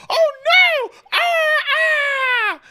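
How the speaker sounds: high, loud hammed-up panic over a fallen baby; a parent losing it in mock alarm; mock panicked parent. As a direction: overplay the frantic parent, shrieking. Mocking panicking parent at fall.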